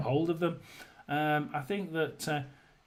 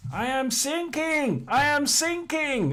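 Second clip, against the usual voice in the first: much higher, louder, slow cry for help; desperate shout; 11 semitones higher, 4 dB louder, slower than usual. Acted. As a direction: call out across the water in panic, slowly. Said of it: "he, he shouts to him".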